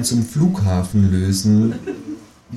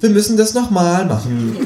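high voice for the screening officer; lightly voiced officer; screener woman; 10 semitones higher than usual.

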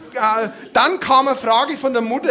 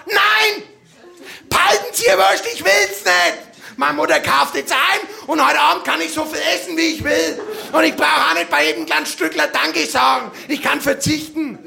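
higher, louder defiant shout; defiant kid shout; 3 semitones higher, 3 dB louder than usual. Pretend. A child finally refuses the saleswoman's sausage, shouting defiantly. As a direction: blow up at the saleswoman like a fed-up kid. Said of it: "ich hätte gerne mal als Kind gesagt".

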